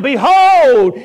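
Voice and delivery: usual speaking voice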